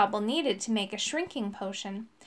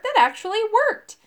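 high, loud disbelief; surprised storybook cry; astonished cry; 9 semitones higher, 5 dB louder than usual. A narrator's reading. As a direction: exclaim it, amazed at the success. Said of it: "cried Urk".

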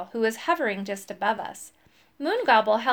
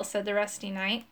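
usual speaking voice

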